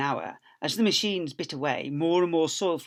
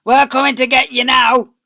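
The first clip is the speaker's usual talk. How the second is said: loud, high gloating call; taunting yell; 10 semitones higher, 14 dB louder than usual. Acted. Bunce yelling at the fox from his tractor. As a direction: holler a threat at the fox. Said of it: "yelled Bunce".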